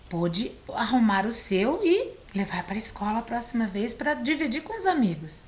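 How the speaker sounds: ordinary delivery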